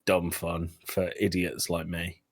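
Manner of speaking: usual speaking voice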